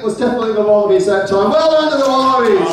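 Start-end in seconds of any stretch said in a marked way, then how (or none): 1.5-2.7 high, celebratory call; game host hailing the winners; winner announcement. Real, not acted. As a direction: congratulate the winning team before the crowd.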